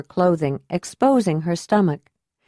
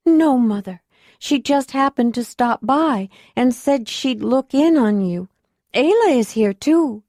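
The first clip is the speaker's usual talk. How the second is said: put-on lightly raised young woman's voice; young acolyte; 6 semitones higher than usual. A narrator's reading. Acolyte voice held.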